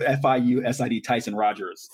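normal talking voice

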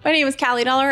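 ordinary delivery

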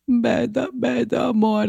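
put-on lifted, tender pleading voice for the old mother; tearful Italian mother. Retelling how the crying Italian mother greeted her, tender and lifted. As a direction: coo it softly like a mother kissing her son's wife.

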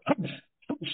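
two short, separated throaty huffs; mimicking a wrestler's throat-clearing entrance noise; imitated throat-clearing. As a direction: bark it like clearing your throat. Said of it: "like he's clearing his throat".

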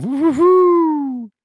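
slow, high, loud whooping cheer; gleeful whoop. Real, not acted. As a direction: whoop like you just saw a great catch. Gleeful about dog flying.

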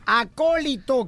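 acólito said slowly, every syllable clear; slow correction. Shown as a demonstration. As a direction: spell out acólito deliberately, a-có-li-to. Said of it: "¿Fue alcohólico en la iglesia?". (acólito pronunciado despacio y claro)

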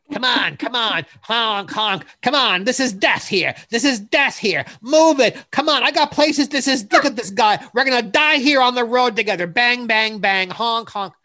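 shouting, high and loud at traffic; impatient road rage driver. Retold or imitated. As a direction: yell at every car like a maniac driver. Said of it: "he was an offensive driver".

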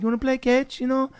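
put-on high, lightly accented kid voice; a sweet kid asking to play, voiced way up high; mild imitation of an Israeli boy; imitating an Israeli kid. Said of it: "he'd be like".

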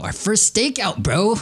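very high-pitched buddy squeal; giddy excitement. Pretend. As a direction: squeal with hammed-up glee at the plan. Player thrilled about stakeout plan.

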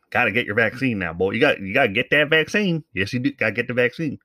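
a hint of a Southern twang, urging; mock Southern drawl. Podcaster imitating Southern politicians, light Southern accent. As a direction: lightly play a Southern governor pushing shots. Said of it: "right-leaning individuals".

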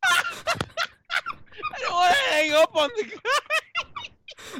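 high, slow words through laughter; can barely get it out for laughing; laughing hard. Real, not acted. Laughing after caller hung up.

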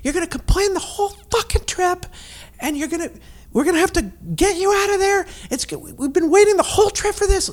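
high, breathy whisper yell; hiss-shout it like a furious whisper; stage whisper. Retold or imitated. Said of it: "he doesn't whisper yell in real life".

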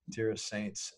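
normal talking voice

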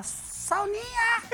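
high, long-drawn mock call; imitated teasing yell. Souza yells a nickname at the player, mocking drawn-out shout. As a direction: holler it high, stretching out the nickname. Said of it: "aí o Souza me grita lá".

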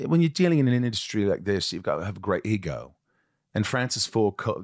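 imitated English accent; American putting on an English actor's accent; Gary Oldman English accent. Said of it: "where would you say I'm from?".

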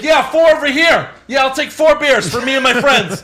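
high, loud shouted order; rowdy fan ordering. Retold or imitated. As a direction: yell for beers across the rows. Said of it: "the guy buying the beers".

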